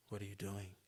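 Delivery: low, quiet imitation of the caller; imitating his different tone. Man calling her at night, imitated in a low, quiet tone. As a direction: drop low and soft, as him. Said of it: "he sounds a bit different. His tone is a bit different".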